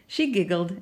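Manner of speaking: ordinary delivery, nothing marked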